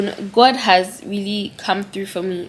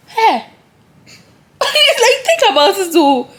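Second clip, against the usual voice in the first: laughing; 11 semitones higher, 6 dB louder, slower than usual. Real, not acted. Amazed laugh about the lucky find.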